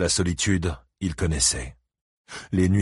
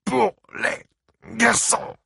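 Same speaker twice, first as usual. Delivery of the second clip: very slow, high, choked words; strangled croak; much slower than usual. A narrator's reading. Buddy Lee pleads while being choked, croaking word by word. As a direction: squeeze out each word with no air left. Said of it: "Chaque mot lui coûtait de précieuses bouffées d'oxygène".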